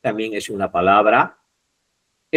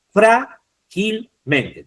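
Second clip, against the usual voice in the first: "frágilmente" slowly split as frá-gil-men-te, raised pitch; syllable by syllable; 8 semitones higher, 3 dB louder, much slower than usual. Shown as a demonstration. Teacher tells students to look closely at frágilmente, syllable by syllable. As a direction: sound out "frágilmente" in separate, drawn-out syllables.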